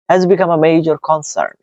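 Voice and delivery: slow, smooth chunk; one flowing phrase, then stop; read as one chunk. Shown as a demonstration. Teacher shows students a chunk, read slowly and smoothly. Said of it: "একটা part করে ফেলেন".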